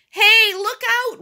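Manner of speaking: very high, loud, drawn-out alarm; slow, high alarmed yell; warning shout. A narrator's reading. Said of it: "warned Robert".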